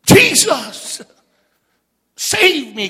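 loud, high cry for rescue. Acted. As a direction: cry out desperately to be saved, imitating the sinking man. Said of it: "He cried out, church".

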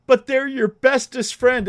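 a hint of a pleading five-year-old; imitating his little son. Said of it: "And he goes".

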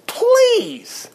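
please flung up very high in disbelief; exasperated falsetto please; mocking high please. Real, not acted. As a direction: squeal please in scornful disbelief. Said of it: "mocking exasperation".

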